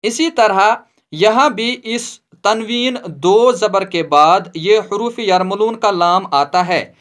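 ordinary talk, nothing marked

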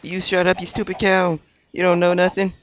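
half-joking rant at a stupid cow; mock angry yell. Yelling at mooing cow.

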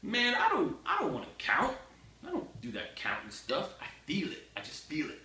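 high whiny student excuse; a mock student whining he just feels it. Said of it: "they're like".

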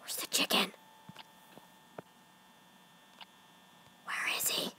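hushed whisper search; scared whisper. Real, not acted. Gamer whispering while searching the cameras. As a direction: whisper afraid.

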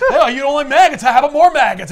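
loud, high taunting demon; mock the victim with cackling relish; hammed-up sadistic glee; Mephisto. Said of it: "Mephisto shows up and he's like".

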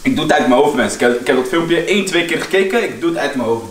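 normal talking voice

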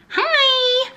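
very high, drawn-out hello; cheeky high-pitched call; playful squeaky greeting. Pretend. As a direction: sing it out high and silly. Playful greeting through see-through leggings.

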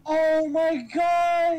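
slow, loud stunned gasp of disbelief; shocked. Real, not acted. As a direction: drag it out in pure shock. Shocked reaction to story reveal.

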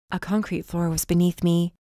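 usual speaking voice

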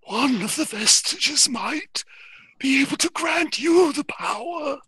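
slow, halting otherworldly character voice; Exothanter. Acted. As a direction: draw each phrase out in a strange creature voice. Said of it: "Exothanter says".

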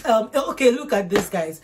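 loud, incredulous set-up; loud exasperation. Real, not acted. Ranting to viewers about cheating, loud exasperation. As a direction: raise your voice in disbelief. Mock outrage at [name] example.

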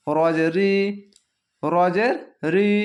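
usual speaking voice

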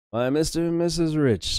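high, loud fawning maître d'; snooty waiter. Acted. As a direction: greet the rich guests with gushing delight. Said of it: "says the maitre d'".

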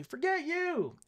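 high mock-snotty kid; imitating a rude kid. A kid telling the teacher off, high and bratty. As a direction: toss it off like a brat. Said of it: "If that kid tells me".

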